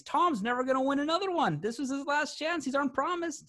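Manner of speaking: high, mock sobbing whine; imitating a crying kid. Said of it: "That's what I was saying".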